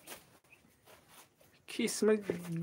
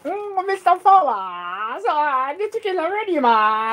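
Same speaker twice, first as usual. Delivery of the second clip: put-on Chinese accent, pitched much higher; mock Chinese voice; 7 semitones higher, slower than usual. Imitating the Chinese shopkeeper on the phone in a mock Chinese accent. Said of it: "change me voice".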